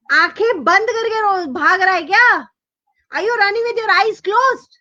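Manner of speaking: high, rapid indignant questions; angry yelling. Acted. Older brother scolding Ajay for the spill, high angry yelling. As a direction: tell him off in a high rush. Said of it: "screamed भैया".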